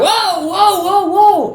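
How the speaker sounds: stopping fellow YouTubers saying the word, alarmed; alarmed whoa. Retold or imitated. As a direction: cut them off in alarm. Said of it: "I'm like".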